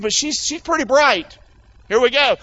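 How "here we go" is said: ordinary talk, nothing marked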